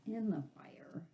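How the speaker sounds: whispered aside; whispered uh-oh moment. Real, not acted. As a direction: breathe it like a secret. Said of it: "aside whisper".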